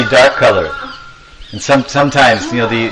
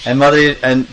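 ordinary delivery, nothing marked

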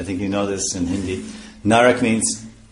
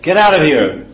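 loud, higher, harsh order to leave; aggressive commanding shout; 5 semitones higher, 9 dB louder than usual. Shown as a demonstration. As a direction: bark someone out of the room. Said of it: "aggressive, aggressive and, uh, and commanding".

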